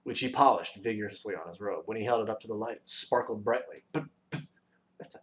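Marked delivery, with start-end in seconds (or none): 3.9-5.2 hesitant, soft, stammered objection; objecting. A narrator's reading. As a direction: stammer a puzzled protest quietly. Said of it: "objected Milo".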